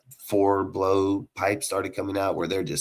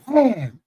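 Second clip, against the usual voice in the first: high, loud wham; big sound-effect wham; imitated impact noise; 11 semitones higher, 7 dB louder than usual. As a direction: voice a hard smash on the ground. Imitating the cop's throw.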